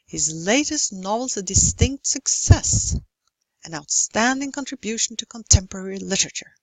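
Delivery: beats on "latest", "novel's", "success", then hurried "contribution to contemporary"; squeezed unstressed syllables. Shown as a demonstration. Lecturer gives longer examples, "latest" and "outstanding" stressed, the rest sped up. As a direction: race through "outstanding contribution to contemporary literature" to hold the rhythm. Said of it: "really have to speed up".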